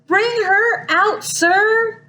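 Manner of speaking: high, slow yelled words; yelled demand. Acted. Sister yelling up at the dragon, slow high demand. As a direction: call it up slowly to the dragon. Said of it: "was the girl yelling".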